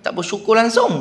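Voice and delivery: high, indignant stall aunty scolding. Retold or imitated. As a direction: tell him off as ungrateful, pitched high. Aunty scolding ungrateful buyer. (mak cik menegur pembeli tidak bersyukur, suara tinggi)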